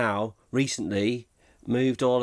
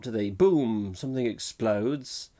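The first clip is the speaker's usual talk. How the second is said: ordinary delivery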